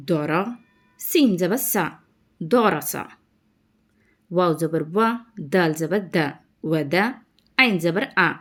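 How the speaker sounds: syllable spelling drill. Shown as a demonstration. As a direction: spell out each sound, then join the word. (అక్షరాలు కలిపి పదం చదవడం)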